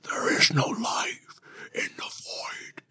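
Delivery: slow whispered dark villain voice; hammed-up villain whisper; mock Sauron line. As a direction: intone it like a dark lord, breathy. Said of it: "Not even in the".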